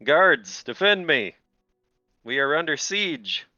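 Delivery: high, loud call for the guards; panicked king yelling for guards. Acted. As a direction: shout to summon help. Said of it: "he's gonna be yelling for his guards".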